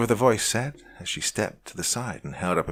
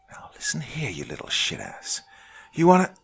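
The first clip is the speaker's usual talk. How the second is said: hissed threat, whisper into a sudden burst; menacing hiss at a kid; 5 semitones higher, 5 dB louder than usual. A narrator's reading. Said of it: "she started to hiss in my face".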